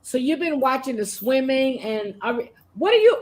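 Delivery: ordinary delivery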